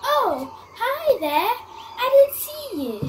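high, bright mock surprise; surprised greeting. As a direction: act caught off guard. Host acts caught by surprise.

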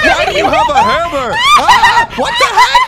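high, loud laughing screams; terrified horror victim. Acted. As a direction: shriek in mock terror. Screams at ghost.

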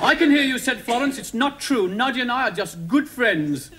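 put-on high, indignant storybook girl; protesting Florence. Florence denying the rumour about Noddy, indignant.

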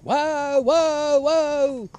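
high, loud panicked whoa; panicked shouting. Retold or imitated. Driver panicking as the horse bolts, loud high yell. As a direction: yell it like you've lost control of the horse. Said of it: "is scream and shout".

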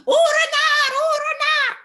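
very high, loud alarm cry; mother crow shouting. Acted. Mother crow warning of the fox, high loud shout. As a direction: shout the warning with all you've got. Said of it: "la maman de Gilles crie".

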